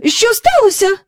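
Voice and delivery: high, loud, alarmed query; startled question. A narrator's reading. Missis Banks running to meet her husband, startled questioning. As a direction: ask urgently what is going on. Wife runs out to meet him.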